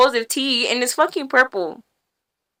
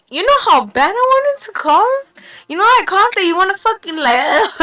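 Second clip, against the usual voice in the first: high exasperated squeal, far higher; exasperated disbelief; 8 semitones higher than usual. Real, not acted. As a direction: pitch it way up in disbelief. Recalling desperate urge to cough.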